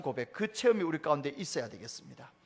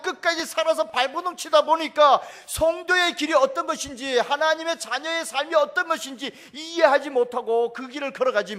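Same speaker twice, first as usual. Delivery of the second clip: loud, high, impassioned sermon delivery; full-force preaching; 7 semitones higher, 10 dB louder than usual. Acted. Pastor preaching on the cross, loud and high. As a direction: proclaim it to the whole congregation.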